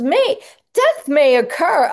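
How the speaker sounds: loud, high, drawn-out mock warning; mock disclaimer. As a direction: blare the warning slowly and high. Mocks a commercial's death disclaimer.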